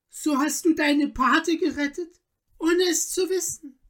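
high, emotional countess voice; joyful recognition. A narrator's reading. Countess tells husband and godson who is who, joyfully moved. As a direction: let the wonder pour out, pitch held high.

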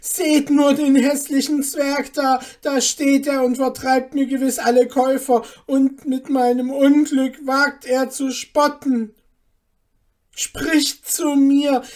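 high, very loud complaint to bystanders; indignant calling out. A narrator's reading. Mother denouncing the dwarf to market women, indignant shout. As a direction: rouse the neighbours with your outrage. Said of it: "rief Hanne ihrer Nachbarin zu".

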